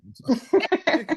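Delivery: slow, high, giggling sorry; laughing apology. Real, not acted. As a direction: apologise while cracking up.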